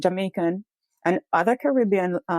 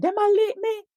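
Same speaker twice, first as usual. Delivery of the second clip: much higher, desperate pleading in patois; retold terrified cry. Man shoved into police car, high terrified pleading. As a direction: wail it like a man being beaten. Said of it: "he said".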